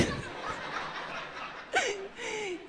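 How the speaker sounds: a long soft high laugh that trails off; quiet laughter fading down. Real, not acted. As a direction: laugh gently at the memory. Laughs at the memory.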